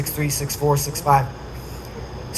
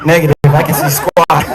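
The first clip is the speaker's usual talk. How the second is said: pilot answering; 4 semitones lower, 6 dB louder than usual. Retold or imitated.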